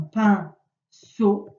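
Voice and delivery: "pinceau" drawn out slowly, pausing between syllables; teacher isolating each syllable of "pinceau"; split into syllables. Shown as a demonstration. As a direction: sound out "pinceau" in two separate beats. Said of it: "la première".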